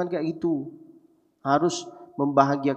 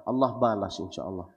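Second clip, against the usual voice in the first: normal talking voice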